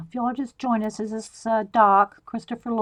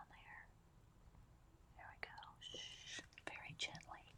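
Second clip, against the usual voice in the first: faint whisper to herself; whispering to self; 30 dB quieter, faster than usual. Real, not acted. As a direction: hush yourself, barely audible. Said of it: "That's what I say in my head to myself".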